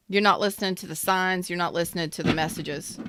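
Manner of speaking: normal talking voice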